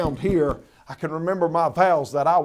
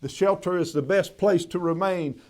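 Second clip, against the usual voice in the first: usual speaking voice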